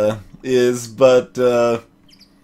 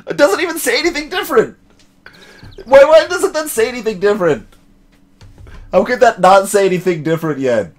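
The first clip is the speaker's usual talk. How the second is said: high, fast exasperated disbelief; can't believe it's the same again; incredulous; 8 semitones higher, 3 dB louder than usual. Real, not acted. Streamer reacting to the unchanged evidence text in disbelief.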